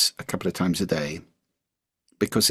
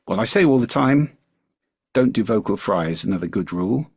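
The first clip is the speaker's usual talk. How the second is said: ordinary talk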